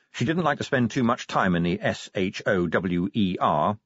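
ordinary delivery

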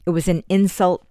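usual speaking voice

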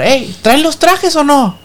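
high, drawn-out call to the band; high slow party yell; shouted request. Retold or imitated. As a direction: holler across the dance floor. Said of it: "que les vuelvo a gritar".

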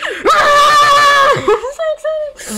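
very high, loud, drawn-out squeal; ear-splitting excitement. Real, not acted. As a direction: scream it slowly through laughter, thrilled. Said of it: "I probably just bursted everyone's eardrums".

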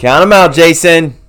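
loud push from the coach; coach shouting. Real, not acted. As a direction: shout him on.

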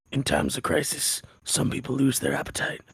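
low gravelly voice, slowing down; weird gravelly voice. Retold or imitated. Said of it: "this weird gravelly voice".

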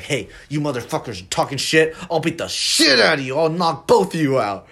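the bar drunk threatening both friends, mock angry yell; angry threat. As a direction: bark it like the drunk picking a fight. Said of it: "and he's like".

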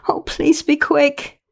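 high, slow, pleading voice; desperate plea. A narrator's reading. Bereaved daughter begging her friend to come, pleading. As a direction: beg helplessly, pitched well up.

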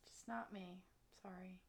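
soft whispered apology; whispered aside. Real, not acted. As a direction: whisper it to yourself. Quiet self-deprecating aside.

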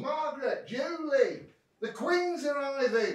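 high, distant call through the house; dad calls the family from off, far and high; calling from another room. Acted. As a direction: shout it from the front room.

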